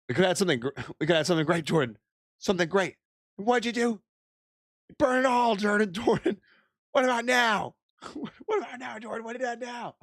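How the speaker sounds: high, hammed-up heartbroken pleading; melodramatic heartbreak. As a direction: wail it like a jilted soap opera lover. Mock breakup plea to Jordan.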